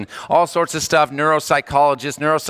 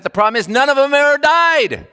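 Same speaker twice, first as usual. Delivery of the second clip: much higher and louder punchline; exclaiming patient; 11 semitones higher, 5 dB louder than usual. Retold or imitated. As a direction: land it like an exasperated patient. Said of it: "He says".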